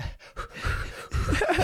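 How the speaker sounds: imitated panicky puffing breaths; acted-out flustered blowing; mock hyperventilating. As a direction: huff out quick breaths like fanning yourself. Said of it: "literally fanning himself".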